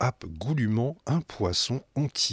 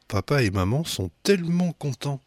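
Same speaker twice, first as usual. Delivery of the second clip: usual speaking voice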